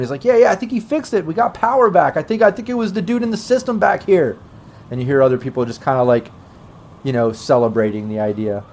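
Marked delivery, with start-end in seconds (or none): 0.2-4.4 excited, high-pitched announcement; rejoicing. Acted. Man in the lobby celebrating the power's return, rejoicing. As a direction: share the good news, pitch lifted high. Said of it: "rejoicing with everyone".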